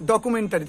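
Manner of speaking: ডকুমেন্টারি as "do-ku-men-ta-ri"; full "ta" in ডকুমেন্টারি; five plain syllables, "dokumentari", for ডকুমেন্টারি; common mispronunciation. Shown as a demonstration. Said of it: "কি উচ্চারণ করি".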